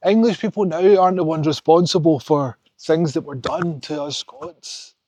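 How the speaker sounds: ordinary delivery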